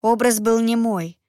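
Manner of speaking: ordinary delivery, nothing marked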